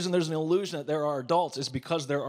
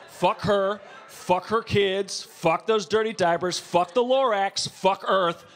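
defiant rant; 6 semitones higher, 4 dB louder, slower than usual. Real, not acted. Ranting defiance, escalating.

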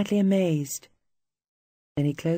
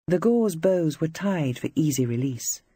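ordinary delivery

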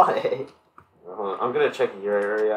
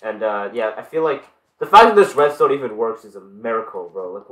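ordinary talk, nothing marked

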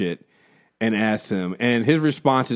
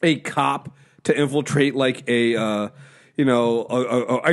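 ordinary talk, nothing marked

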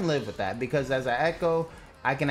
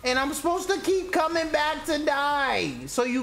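indignant rant; 9 semitones higher than usual. Real, not acted. Berating teammate over voice chat.

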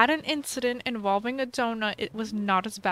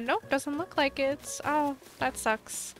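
normal talking voice

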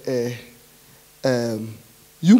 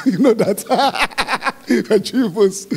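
chuckling through the words; amused, laughing delivery; 3 semitones higher than usual. Real, not acted. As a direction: laugh as you repeat the joke. Laughs at own Nigerian bones joke.

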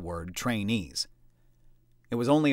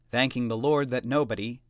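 ordinary delivery, nothing marked

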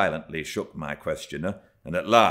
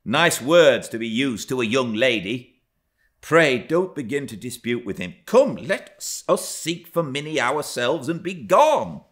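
loud, raised, urgent scolding; chide, then hurry the child away; reproving nurse; 7 semitones higher, 6 dB louder than usual. A narrator's reading. Nurse steering Miss Cathy from the quarrel, loud reproving voice.

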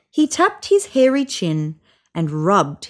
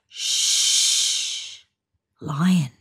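softer urgent hush; hushed warning; 4 semitones lower, 4 dB quieter than usual. Acted. Giraffe hushing neighbours as lion nears, soft and urgent. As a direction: warn them under your breath.

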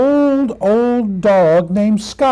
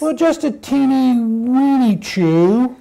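higher, wheedling puppy voice; little puppy; 4 semitones higher than usual. Acted. Puppy owns up to mother about chewing, little puppy voice. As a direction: play down the mischief as a puppy.

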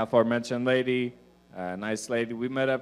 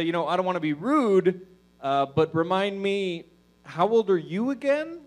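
fake-polite question; 6 semitones higher than usual. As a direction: ask sweetly but pointedly. He politely asks her age.

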